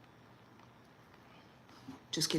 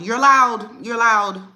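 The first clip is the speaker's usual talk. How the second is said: loud, firm reassurance; emphatic; 4 semitones higher, 11 dB louder than usual. Real, not acted. Telling moms firmly and loudly they are allowed. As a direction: insist on it. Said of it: "emphatic reassurance".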